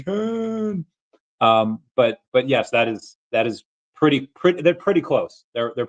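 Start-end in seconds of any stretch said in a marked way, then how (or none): 0.0-0.8 high, softer mock cry; Khan cry. Explaining what he did in the mirror, high mock cry. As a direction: let the name ring out. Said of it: "for me, I was just saying".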